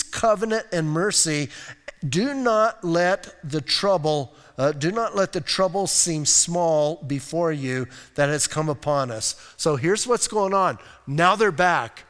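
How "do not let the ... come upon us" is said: usual speaking voice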